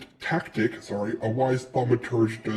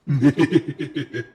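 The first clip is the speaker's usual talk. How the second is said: quick rippling chuckle; giggle; 4 dB louder than usual. Real, not acted. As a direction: giggle to yourself. Laughs at own remark.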